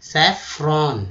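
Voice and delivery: सैफरॉन said slowly and clearly as "sai-fron"; slow pronunciation demo. Shown as a demonstration. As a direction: spell out सैफरॉन slowly for students. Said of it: "केसर जो होता है सैफरॉन". (सैफरॉन धीरे और साफ़ बोलें)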